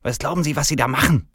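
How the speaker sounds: high, indignant manager; venomous snapping. A narrator's reading. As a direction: snap at him, outraged. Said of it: "giftet er mich an".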